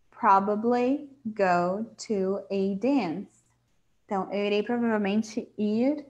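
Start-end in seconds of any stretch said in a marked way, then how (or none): 0.1-3.4 very slow, careful reading; slow model reading. Shown as a demonstration. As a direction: stretch it out for learners. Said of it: "Como o exemplo que o Dave dá aqui". (leitura muito lenta e didática)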